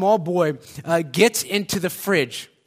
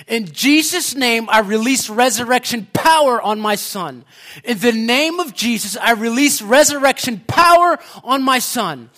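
loud, forceful declaration in prayer; retold shouting; 3 semitones higher, 5 dB louder than usual. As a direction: act out a mother's commanding cry. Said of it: "she's shouting this at the top of her voice".

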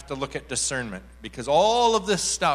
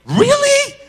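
piercingly high, loud disbelief; amazed squeal; 14 dB louder than usual. Retold or imitated. Woman told that she is praying, amazed squeal. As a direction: imitate squealed astonishment.

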